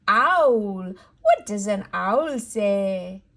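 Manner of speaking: ordinary delivery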